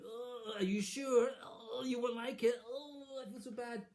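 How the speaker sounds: milk the illness to get her wish; fake sick whine. Queen whining to the king, high and hammed-up. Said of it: "pretended to be sick".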